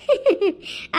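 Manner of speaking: short, gloating chuckle; gloating laugh. Acted. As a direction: laugh in triumph at her humiliation. Mother gloats after son slapped wife.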